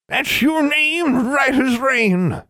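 high, very slow crone; ancient crone voice. A narrator's reading. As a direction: creak it out like an ancient witch. Oldest crone speaks.

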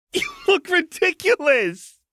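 high, slow voice fighting laughter; laughing taunt. A narrator's reading. Jinx laughs at Lux in the sweater, giggling. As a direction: squeeze it out through giggles.

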